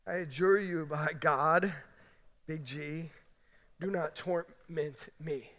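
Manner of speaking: soft, low, slow pleading demon; the demon begs quietly and slowly, afraid; quiet demon plea. Acted. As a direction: hush it down to a fearful murmur. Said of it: "The demon said".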